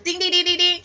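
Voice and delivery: bell ding. Shown as a demonstration. Imitating a class bell.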